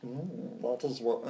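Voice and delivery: softly attempted alien master voice; a half-hearted, quiet creature impression; Yoda. Podcaster retrying an alien master, faint half-hearted impression. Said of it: "I can't do Yoda".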